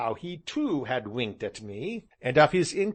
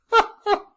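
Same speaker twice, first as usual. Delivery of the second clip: high whooping laughter; loud laugh; 10 dB louder than usual. Acted. Gentleman rocking to and fro, loud laughter. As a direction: roar with laughter.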